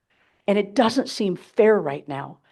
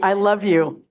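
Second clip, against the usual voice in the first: normal talking voice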